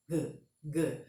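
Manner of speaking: "G, G" as short hard consonant sounds, "guh"; isolated G sound. Shown as a demonstration. Teacher shows students the consonant's own sound, isolated "G, G". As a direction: release each G briefly and low, without an ee. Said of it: "elas têm o som delas mesmo".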